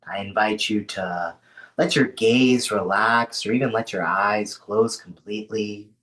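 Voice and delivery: unhurried grounding voice with long pauses; guided meditation. Acted. Host settling an online group into rest, guided meditation. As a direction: ease them into rest, leaving space between phrases.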